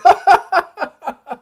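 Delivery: loud, high burst of laughter; can't stop laughing; real hearty laugh. Real, not acted. Golfers running from storm.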